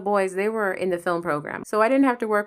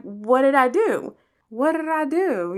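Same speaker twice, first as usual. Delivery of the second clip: puzzled plea; 6 semitones higher, 3 dB louder than usual. Retold or imitated. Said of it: "I'm like".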